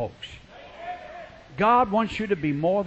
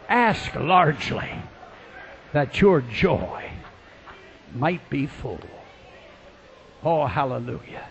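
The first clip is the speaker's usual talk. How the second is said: low, slow, fading away; hushed solemn preaching; 6 semitones lower, 5 dB quieter than usual. Real, not acted. Preacher closing on a scripture promise, slow and hushed. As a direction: let each phrase sink softly.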